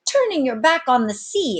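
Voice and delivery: high, loud astonished cry; amazed exclaim. Acted. Sailmaker amazed at the captain, high and loud. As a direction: gape at the captain. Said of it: "exclaimed Trot in open-eyed amazement".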